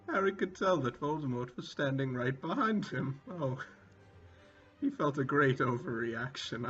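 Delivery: normal talking voice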